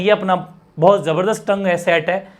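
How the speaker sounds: ordinary talk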